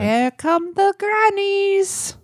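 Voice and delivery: high, slow quavering granny. Pretend. Trying out a granny voice for the podcast. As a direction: croak it out like a little old granny. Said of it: "we would just talk like grannies".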